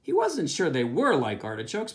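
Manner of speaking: plain reading voice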